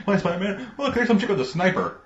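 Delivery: high-pitched New Yorker greeting a superhero; excited bystanders. Acted. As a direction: call out like a comic-book passerby. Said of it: "people would be like".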